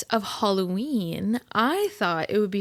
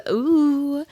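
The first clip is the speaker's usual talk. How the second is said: spooky ooh; 6 semitones higher, 6 dB louder than usual. Acted. Spooky theme announced.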